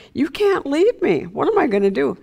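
high pleading cry on leave, dropping back; anguished plea. Retold or imitated. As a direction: plead like a husband losing his wife. Husband begging dying wife.

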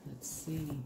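murmured softly to herself. Real, not acted. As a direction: drop it to a soft murmur. Murmuring to herself while reading.

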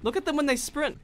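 normal talking voice

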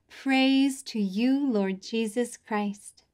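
slow, drawn-out liturgical response; slow modelled response. Shown as a demonstration. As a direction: stretch each word for kids to copy. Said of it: "Your turn".

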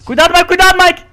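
loud, high urgent call; warning shout. Real, not acted. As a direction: yell a warning. Warning friend of danger.

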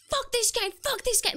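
high, loud mock screaming; mock gamer rage. Teammates screaming at each other, mock rage. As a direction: imitate raging teammates yelling. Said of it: "they started screaming at each other".

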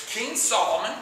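ordinary talk, nothing marked

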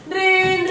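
loud, high trilled ringtone; held high ring noise; imitated phone ring. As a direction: sing out an insistent phone ring.

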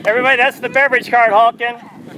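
booming tee-box introduction; announcer. Acted. Announcing next golfer.

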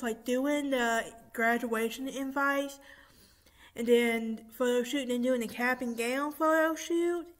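ordinary delivery

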